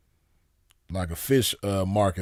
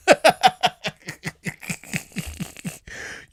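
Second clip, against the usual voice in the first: long high-pitched laugh; real laugh; 12 semitones higher than usual. Real, not acted. Storyteller amused by his Jamaican impression, high laughter. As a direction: crack up at your own impression.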